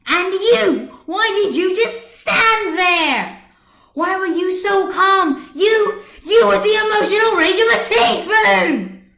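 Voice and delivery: screaming at Chat in fury; furious shout. Acted. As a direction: turn on him and scream it. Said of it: "line of her anger".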